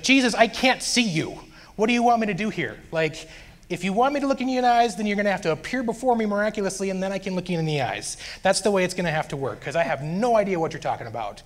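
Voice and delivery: high-pitched exasperated protest; worked-up, frustrated complaint. Retold or imitated. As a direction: argue back in exasperation, high then easing down. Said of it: "I was getting frustrated".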